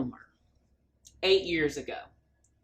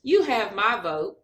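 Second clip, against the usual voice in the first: usual speaking voice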